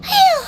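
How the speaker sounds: relieved phew. Acted. Relief after being scared.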